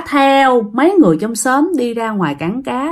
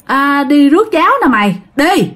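higher-pitched call to a friend; 4 semitones higher than usual. Retold or imitated. Neighbors call Aunt Tám to fetch the kids, higher-pitched call. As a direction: call her name across the room. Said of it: "người ta mới kêu".

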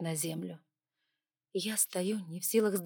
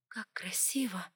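whispered; 6 dB quieter than usual. A narrator's reading. Inner thought at the death.

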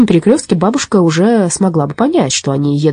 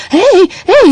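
high shrill-free cry of alarm, much higher and louder; shouting; 6 dB louder than usual. A narrator's reading. Granny shouting at the robber to stop. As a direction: call out in alarm from the back of the bike. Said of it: "закричала бабушка".

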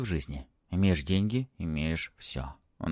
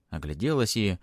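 ordinary delivery

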